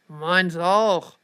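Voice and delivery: loud, irritated grumble; annoyed growl. A narrator's reading. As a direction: growl it out crossly. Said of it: "knurrte der mit der Tätowierung ärgerlich".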